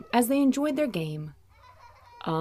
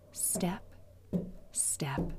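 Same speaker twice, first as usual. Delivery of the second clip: softer, hushed footstep words; whispered; 2 semitones lower, 12 dB quieter than usual. A narrator's reading. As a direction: breathe each step softly.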